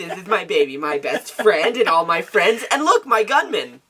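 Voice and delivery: hammed-up gushing, slowing and rising at the end; mock happy. Mimicking a too-happy character showing off family and friends, mock happy. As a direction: proudly show off, overdone. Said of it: "It's too happy".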